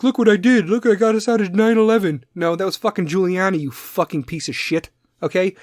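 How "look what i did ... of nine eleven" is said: high, loud boastful mockery; mock credit-grabbing boast; Bloomberg. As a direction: brag like a self-important politician. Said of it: "Bloomberg's like".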